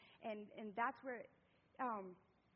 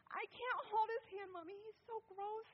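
put-on high-pitched disgusted kid voice; squeamish little girl; 12 semitones higher than usual. Daughter forced to hold her brother's hand, squeamish. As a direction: imitate a child complaining to mommy, grossed out. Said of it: "getting all squeamish".

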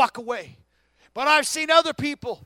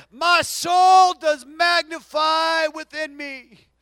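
slow, loud exultant shout; preacher voicing Mary's song of praise; 5 semitones higher, 8 dB louder, much slower than usual. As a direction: ring it out in worship. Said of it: "She said".

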